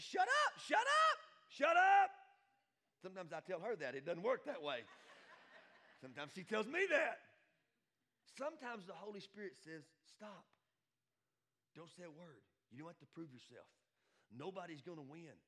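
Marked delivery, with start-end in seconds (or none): 0.0-2.1 very high, loud repeated shout; shouted interruption. Acted. Acting God interrupting an argument. 10.0-15.4 soft, restrained counsel; gentle admonition. Acted.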